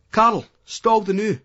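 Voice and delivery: roaring. A narrator's reading. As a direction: roar after his mate down the road. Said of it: "Terry roars".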